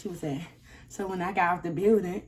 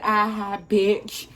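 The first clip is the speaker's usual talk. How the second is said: retold taunt; 2 semitones higher, 7 dB louder, much slower than usual. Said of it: "I was just real disrespectful".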